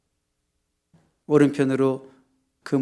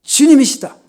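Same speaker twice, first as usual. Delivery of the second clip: high, loud cry of recognition; full-voiced, urgent exclamation; excited shout; 12 semitones higher, 12 dB louder than usual. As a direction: shout the discovery to everyone in the boat. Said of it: "뭐라고 소리 지르냐면".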